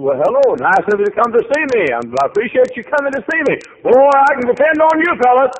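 high, loud preacher's cry; preaching at full force. Acted. Preacher crying out Job's losses to the congregation, full force. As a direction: shout the loss out over the pews.

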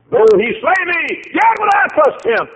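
high, loud ringing proclamation; preaching at full force. Acted. Preacher voicing Job's vow to the congregation, ringing out loud. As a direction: fling the vow to the rafters.

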